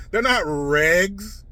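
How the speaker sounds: normal talking voice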